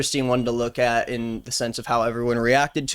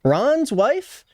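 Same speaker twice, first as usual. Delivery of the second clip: mock surprise; 8 semitones higher, slower than usual. Real, not acted. Jokes about reporter's name.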